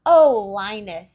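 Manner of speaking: swooning. Acted. Sally charmed by Linus, slow swooning sigh. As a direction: melt over him. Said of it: "swooned Sally".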